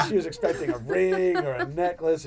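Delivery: ordinary talk, nothing marked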